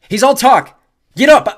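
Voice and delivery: very loud, high urging; urgent full-volume command; rallying shout. A narrator's reading. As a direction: yell to get a friend on their feet. Rallying friend mid-fight.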